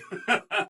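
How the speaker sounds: short giggle of glee; gleeful chuckle. Acted. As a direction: chuckle with mischievous delight. Gleeful laugh before the mayhem.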